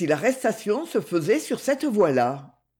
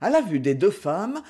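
ordinary delivery

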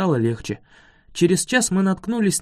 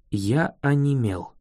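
ordinary delivery, nothing marked

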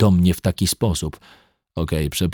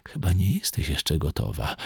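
hushed, much softer aside; very quietly; 8 dB quieter than usual. A narrator's reading. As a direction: drop the voice to a near hush. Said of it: "a do mnie już cichutko". (cichutko, półgłosem)

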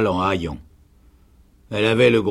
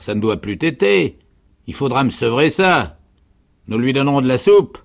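loud, high, bossy soldierly woman; soldierly canteen woman; 4 semitones higher, 7 dB louder than usual. A narrator's reading. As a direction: decree it loudly, barracks-style. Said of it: "sa voix soldatesque et féminine". (vivandière soldatesque, forte et autoritaire)

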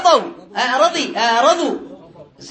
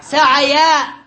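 drawn-out example word; 3 semitones higher, much slower than usual. Shown as a demonstration. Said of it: "فنقول في سعى".

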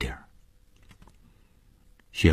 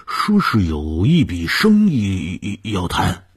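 put-on flustered old servant; 4 semitones higher, 4 dB louder than usual. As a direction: report haltingly to the lady of the house. (结结巴巴通报的老仆人)

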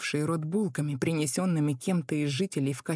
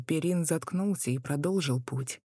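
ordinary delivery

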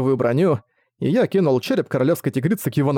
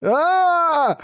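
high drawn-out scream of agony; agonized scream. Acted. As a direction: scream like a dying man. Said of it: "закричал Картинас, словно в предсмертной агонии".

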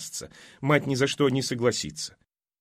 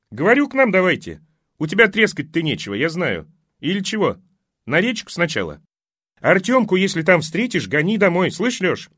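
calling out; 5 semitones higher, 4 dB louder than usual. Acted. Neighbour shouting invitations.

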